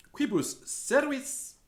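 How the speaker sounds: high, slow question. Acted. Teacher repeating the question to his class, high and slow. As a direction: ask it brightly.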